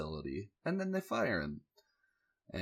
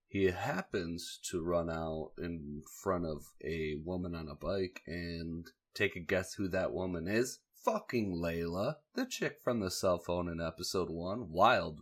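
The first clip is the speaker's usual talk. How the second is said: ordinary talk, nothing marked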